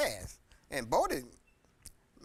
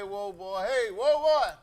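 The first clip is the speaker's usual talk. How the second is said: calling out, louder, building up; granddad calling out; 3 semitones higher, 7 dB louder, slower than usual. Retold or imitated. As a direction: call it across the field like grandpa. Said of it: "He'll say".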